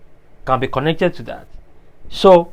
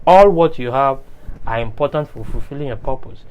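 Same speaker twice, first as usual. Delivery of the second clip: normal talking voice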